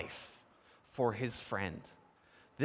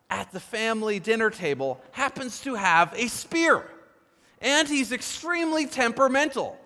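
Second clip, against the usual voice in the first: louder and higher, driving to a peak; building passion; 10 semitones higher, 9 dB louder than usual. Real, not acted. Preacher painting Saul's temper to the congregation, heated build. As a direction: preach it with rising fire.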